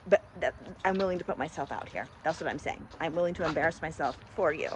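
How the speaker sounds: normal talking voice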